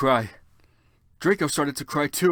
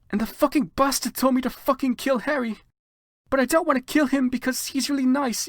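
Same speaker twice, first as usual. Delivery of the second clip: shrill hysterical rant; 14 semitones higher, 5 dB louder than usual. Acted. Heroine's tearful rant, high loud.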